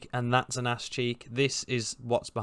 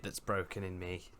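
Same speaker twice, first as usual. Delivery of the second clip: usual speaking voice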